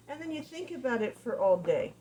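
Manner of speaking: normal talking voice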